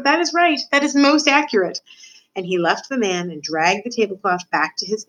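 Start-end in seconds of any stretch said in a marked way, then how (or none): 0.0-1.8 high-pitched, self-satisfied agreement; smug little villain, pitched high; gloating rat. Acted. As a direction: accept the insult with relish. Rat relishes being called dirty.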